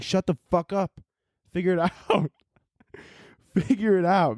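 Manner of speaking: a touch of fed-up outrage; mock annoyed scolding. Imagining himself telling off orphan Annie, mock annoyed. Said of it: "I'd be like".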